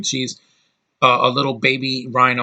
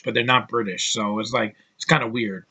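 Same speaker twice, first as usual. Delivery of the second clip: ordinary talk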